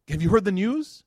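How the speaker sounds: high, loud, eager question; excited news-bringer. Retold or imitated. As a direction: burst in with big news. Cousin bringing big news to master.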